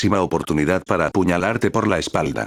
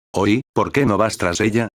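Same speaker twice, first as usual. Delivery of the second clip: ordinary delivery, nothing marked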